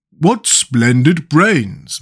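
ordinary delivery

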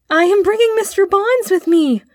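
high, loud hail; boy shouting news into the distance; shouted. A narrator's reading. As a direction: call ahead with excited pride. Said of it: "he shouted again".

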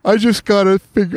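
words stretched through a yawn. Real, not acted. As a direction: talk mid-yawn, drawn out and slow. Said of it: "oh, sorry, I'm yawning".

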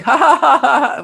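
high, loud fake laugh; loud high ha-ha-ha imitation; imitated laughing. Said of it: "he's just laughing".

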